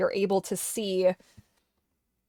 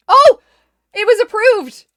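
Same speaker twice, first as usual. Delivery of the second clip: high, loud burst of delight; surprised delight; 13 semitones higher, 15 dB louder, slower than usual. Real, not acted. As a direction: gasp with real happy surprise. Surprised at approval.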